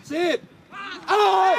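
short, loud sideline shouts; calling out. Real, not acted. Coach praises, then halts a player, calling out. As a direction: yell it across the pitch.

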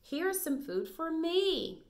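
slow puppy voice rising high at the end; little puppy. Acted. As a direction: draw it out like a little puppy finding food. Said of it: "said the little puppy".

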